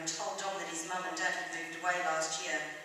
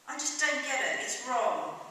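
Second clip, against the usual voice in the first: higher, louder, indignant; frustrated protest; 6 semitones higher, 5 dB louder than usual. A narrator's reading. Drinker to landlord about the pub closing, frustrated. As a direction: protest as if thumping the bar. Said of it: "He thumped his fist".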